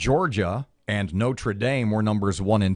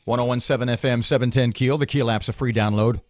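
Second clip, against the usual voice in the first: ordinary delivery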